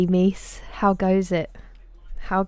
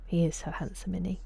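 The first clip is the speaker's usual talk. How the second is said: usual speaking voice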